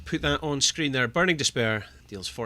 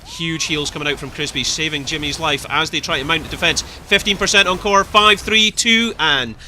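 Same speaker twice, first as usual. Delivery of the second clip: building louder and higher to a long drawn-out finish; climactic play-by-play; 8 semitones higher, 6 dB louder, slower than usual. Acted. Caster calling the core's fall, climactic play-by-play. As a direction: count down, then hold the last letters high and long. Live call of match end.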